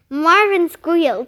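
usual speaking voice

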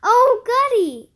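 high, loud, gleeful exclamation; excited storybook squeal; delighted child. A narrator's reading. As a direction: cheer it with childlike glee. Child delighted by fire drill.

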